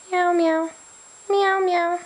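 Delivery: high, loud meowing like a cat; nervous cat voice. Acted. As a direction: meow up high to amuse the baby. Meowing to amuse baby.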